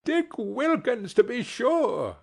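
high, happy exclamation; delighted recognition. A narrator's reading. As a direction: greet the sight of an old friend. Scrooge recognising his old friend.

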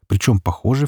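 ordinary delivery

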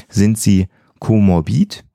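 ordinary delivery

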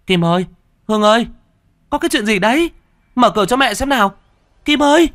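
a worried mother banging on the door, calling out; frantic mother calling through a locked door. Acted.

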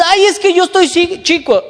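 high, loud whiny excuse; mock a spoiled youth complaining; whiny young believer. Preacher mocks a young believer's excuse, high and whiny.

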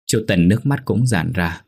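plain reading voice

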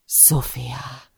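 hushed, whispered name from an intruder; deep-voiced address. A narrator's reading. Man in the dark addresses the captive heroine, in a deep hushed voice. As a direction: whisper the name low, almost without voice. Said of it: "szólít meg mély hangján".

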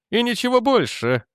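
high-pitched, final dismissal; curt dismissal. A narrator's reading. Jannek refusing to discuss the weapon further, curtly. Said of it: "отрезал Дженнек".

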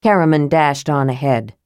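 ordinary delivery

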